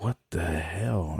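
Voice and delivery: slow, soft, under the breath; muttered disbelief. Retold or imitated. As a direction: mutter it slowly to yourself, stunned. Inner reaction to failed notes.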